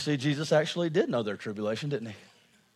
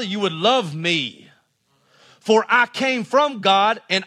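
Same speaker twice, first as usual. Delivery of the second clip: raised, ringing scripture reading; forceful preaching; 6 semitones higher, 6 dB louder than usual. Real, not acted. Preacher reading Jesus's challenge to the congregation, forceful preaching. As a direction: drive each verse home to the congregation.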